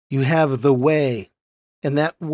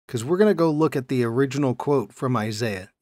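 ordinary talk

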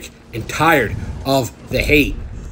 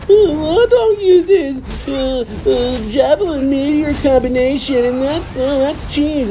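much higher, stretched whining of a complainer; mocking whiny critic; 12 semitones higher, slower than usual. Streamer mocking players who call his combo cheese, in a high whining voice. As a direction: drag it out like a sulky loser. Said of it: "They get packed up".

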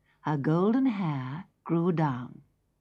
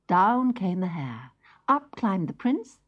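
usual speaking voice

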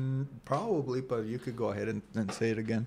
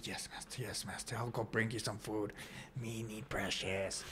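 hushed, breathy creature whimper, much softer; Smeagol voice; 11 dB quieter than usual. Retold or imitated. A man mocking submissive men, hushed Smeagol impression. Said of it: "Smeagol didn't get precious".

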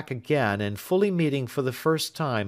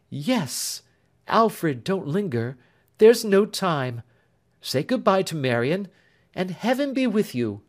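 lightly voiced gentle sister; 8 semitones higher, 3 dB louder than usual. Elder sister answers her betrothed gladly. Grace sends Alfred off.

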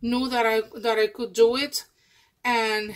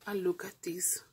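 hushed whisper; 3 semitones lower, 10 dB quieter than usual. Real, not acted. Showing the viewers a pocket detail, whispered. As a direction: breathe it like a secret.

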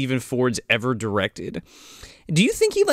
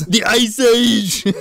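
slow, loud, higher villain pun, stretched out; Mister Freeze pun; 7 semitones higher, 6 dB louder, much slower than usual. Acted. Joking friends answering the riddle in a booming villain pun. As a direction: boom the pun like a hammy ice villain.

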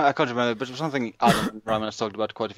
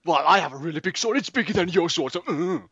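mock boast; 6 semitones higher than usual. Recorded take of mock fight.

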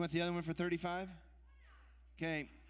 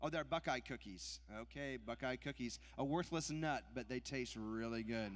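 ordinary talk

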